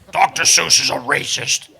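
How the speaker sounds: low, put-on denouncing voice; low mock condemnation; mocking accuser. Mocking those who cancelled Seuss.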